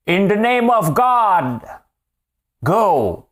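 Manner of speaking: put-on high, slow, loud British accent; mock British MP thundering in English. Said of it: "Avec l'accent british que j'ai essayé d'imiter". (imiter l'accent britannique, haut et fort)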